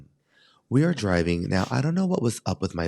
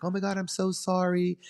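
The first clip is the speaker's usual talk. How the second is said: mock apologetic girl; 10 semitones higher than usual. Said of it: "I hear the girl who hit us".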